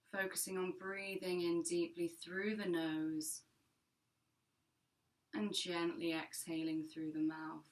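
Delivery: calm, measured meditation voice with long pauses; guided meditation. Acted. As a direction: guide listeners gently into their breath, unhurried and even.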